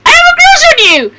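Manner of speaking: fast, high, loud blurt; blurted confession. Acted. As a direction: blurt it all out at once.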